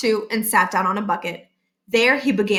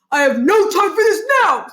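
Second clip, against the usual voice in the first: higher, raised gruff principal voice; 8 semitones higher, 5 dB louder than usual. Acted. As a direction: cut him off impatiently. Barkin brushing off caller.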